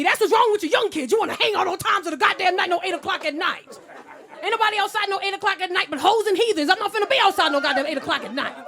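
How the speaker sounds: put-on loud, high ranting old man; hammed-up old man tirade; old man yelling. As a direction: rant at the young folks like a cranky elder. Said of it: "He started yelling at me".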